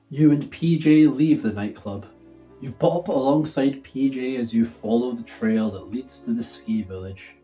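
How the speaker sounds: ordinary delivery, nothing marked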